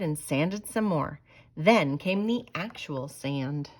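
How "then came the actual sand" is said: plain reading voice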